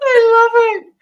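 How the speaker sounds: high squealing laugh through the words; delighted laughing squeal; laughing out loud. Real, not acted. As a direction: laugh as you say it. Laughing hard at own story.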